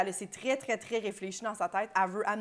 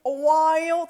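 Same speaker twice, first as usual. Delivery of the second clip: high, loud mock-American state names; proud American shout; 8 semitones higher, 7 dB louder, slower than usual. Cohost imitates Americans naming their home state, exaggerated and proud. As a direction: belt out each state like a boast. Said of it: "ils le disent comme".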